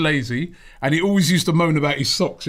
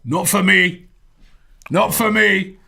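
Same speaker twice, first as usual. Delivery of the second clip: dismissive mock Scottish, a bit louder; bad Scottish accent; 4 semitones higher, 3 dB louder, slower than usual. Scottish fan refusing to rate Henry, mock Scottish accent. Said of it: "That's not a very good Scottish accent".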